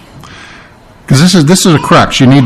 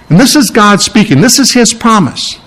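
normal talking voice

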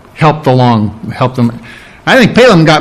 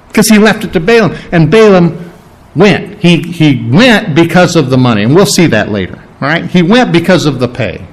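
usual speaking voice

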